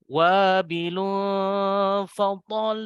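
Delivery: wabilun fatal drawn out, voice lifted on fatal. Shown as a demonstration. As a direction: stretch fatal and raise it. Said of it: "Naikkan ya suaranya".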